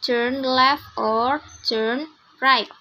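slow, careful turn left or turn right; teacher modelling turn left and turn right clearly; correct pronunciation. Shown as a demonstration. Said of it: "Jadi yang benar itu".

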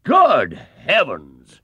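put-on loud, slow, booming old gentleman; pompous chairman booming in alarm; booming surprise. A narrator's reading. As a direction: boom out the shock. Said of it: "boomed Sir Huntley".

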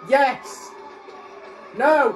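high, loud excited yells; excited shout. Real, not acted. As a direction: shout at the screen. Cheering on the TV scene.

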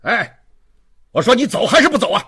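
Javert yelling. A narrator's reading. Javert in mid-room demanding she leave, yelling. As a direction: yell it impatiently in a rush. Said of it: "嚷嚷道".

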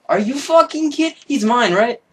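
very high, loud outraged disbelief; shocked disbelief. Real, not acted. Gamer sees his dog claimed, very high shocked yell. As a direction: squeal in outrage. Dog tamed by someone else.

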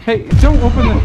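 loud high gasping cry; startled shout. Real, not acted. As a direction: shout in sudden alarm. Sudden discovery.

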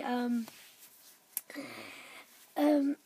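ordinary talk, nothing marked

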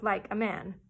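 plain reading voice

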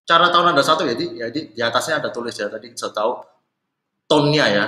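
ordinary talk

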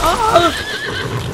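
high, loud yelp of alarm; startled cry. Real, not acted. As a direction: cry out as if caught off guard. Game event startles the player.

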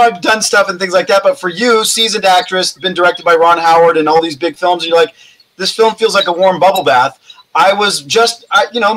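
normal talking voice

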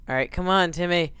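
ordinary delivery

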